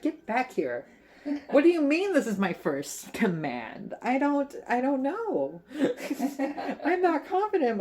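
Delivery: rising, high-pitched flustered objection; playfully acted fluster; mock panicked protest. Acts out flustered Calamy's protest.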